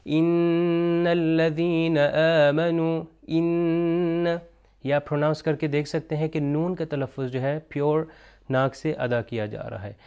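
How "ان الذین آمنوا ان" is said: ان with the n held long, hummed through the nose; slow Quranic recitation, ringing the doubled n in ان; nasal noon. Shown as a demonstration. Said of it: "وہ ناک سے نکلی جائے گی".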